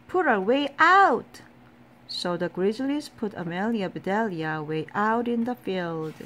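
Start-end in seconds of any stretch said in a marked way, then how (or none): 0.1-1.4 angry team shouting, high and loud. A narrator's reading. Angry teammates banishing a player to the outfield, loud high shout. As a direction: shout the order, punching the last word. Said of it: "they shouted".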